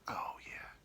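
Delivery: soft, put-on seductive whisper; hushed mock seduction; whispered come-on.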